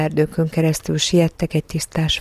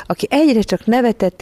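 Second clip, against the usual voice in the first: ordinary delivery, nothing marked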